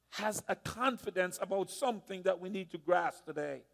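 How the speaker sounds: ordinary talk, nothing marked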